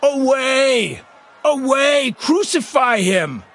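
very high mob cry; shouting. A narrator's reading. Crowd rejects their king before Pilate, shouting. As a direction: yell it in bursts, stretching the last words. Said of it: "But they shouted at him".

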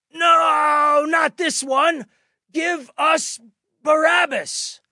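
very high, loud, drawn-out shout; crowd shouting. A narrator's reading. The crowd demanding Barabbas from Pilate, full shout. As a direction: yell it like an angry mob.

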